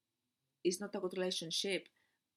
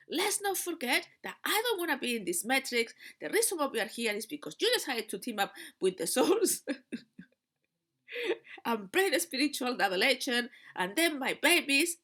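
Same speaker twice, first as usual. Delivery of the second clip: higher, louder reproach, pressing the blame; guilt trip; 6 semitones higher, 6 dB louder than usual. Retold or imitated. Lecturing her silent partner about the matrix, a louder guilt trip. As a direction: pile the guilt on him. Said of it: "I go on to the guilt trip".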